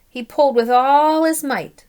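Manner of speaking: loud, pulling hard; strained heave of effort; straining effort. A narrator's reading. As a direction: push the words like hauling a rope. Straining pull in story.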